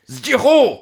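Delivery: high, drawn-out call; roaring call. Acted. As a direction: roar the name across the field. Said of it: "No i ryczą".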